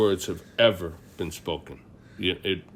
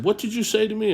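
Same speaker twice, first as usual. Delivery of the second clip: much higher, indignant challenge; stern grandfather; 13 semitones higher, faster than usual. Retold or imitated. Grandfather challenging his grandson over deer hunting, indignant. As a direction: demand it back, daring him to repeat it.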